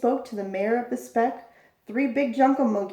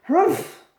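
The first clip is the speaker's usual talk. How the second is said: loud, higher scoffing humph; scornful humph; 6 semitones higher, 6 dB louder than usual. A narrator's reading. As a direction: snort in disdain. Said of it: "humped a voice".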